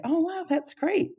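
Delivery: encouraging delight. Shown as a demonstration. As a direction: demo bright encouraging wonder. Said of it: "You just say".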